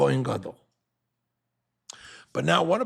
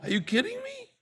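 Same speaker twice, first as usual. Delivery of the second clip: Moshe throwing it back in disbelief; incredulous Moshe. Retold or imitated. Moshe to Korach, a high incredulous outburst. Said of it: "Moshe said".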